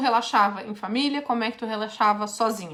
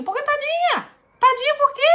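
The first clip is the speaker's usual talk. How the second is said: incredulous challenge; 5 dB louder than usual. Real, not acted. Coach challenges pity for daughter.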